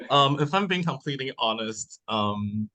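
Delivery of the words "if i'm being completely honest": ordinary delivery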